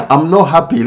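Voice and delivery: real laugh through not happy; laughing despite upset. Real, not acted. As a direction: laugh it off while saying you're not happy. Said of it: "It's not like I'm laughing".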